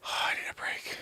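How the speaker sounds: whispered, out of breath from dancing; a tired aside, barely voiced; breathless whisper. Real, not acted. Streamer to chat after dancing, breathless whisper.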